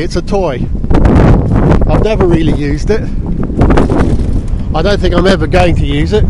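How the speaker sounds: loud, pushing through wind noise; shouting over wind. Real, not acted. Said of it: "Don't know if you can hear me over the wind rush noise".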